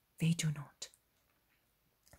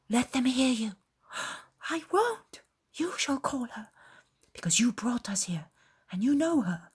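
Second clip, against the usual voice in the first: nervous arguing; 6 semitones higher than usual. Acted. Shepherds argue over calling Mary.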